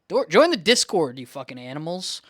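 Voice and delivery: loud, mock-rude call to the viewers; playful angry shout at the viewers; mock yell. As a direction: bark it at the audience like a joke. Yelling at viewers to join.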